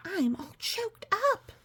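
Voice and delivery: high, lightly voiced moved fan; mock-tearful high voice; a touch of choked up. A fan is moved by the tribute, lightly hammed-up tearful.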